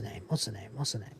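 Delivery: muttered whisper to himself; whispered aside. Real, not acted. Host forgetting the actress's name, a whispered aside. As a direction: breathe it out under the breath. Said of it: "forgetting a name".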